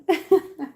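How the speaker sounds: loud, high burst of laughter falling away. Real, not acted. Vlogger laughing off a reaction to her name with a high laugh. Laughs after "it's fine.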